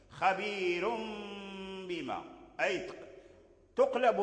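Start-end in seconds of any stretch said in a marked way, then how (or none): 0.1-2.2 drawn-out nasal M joining "خبير" to "بما"; tanween becomes meem. Shown as a demonstration. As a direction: hold the M at the end of "خبير" before "بما". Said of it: "التنوين أيضًا ميما عند الباء".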